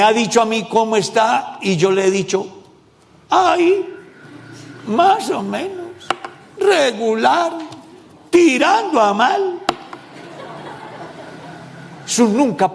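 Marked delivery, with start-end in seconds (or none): 3.3-9.6 high, slow mock sad whine. Pastor mocking a gloomy believer, high sad whine. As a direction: whine like a glum churchgoer.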